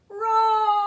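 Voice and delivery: big high loud roar; playful dragon roar. Shown as a demonstration. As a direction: roar for the children. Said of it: "can you make a roaring sound".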